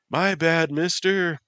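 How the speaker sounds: put-on high, bright kid's voice; a cheeky little boy calling out; young boy.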